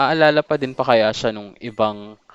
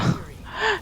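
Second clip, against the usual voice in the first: nervous laugh; 5 dB quieter than usual. Real, not acted. Streamer panics in boss fight.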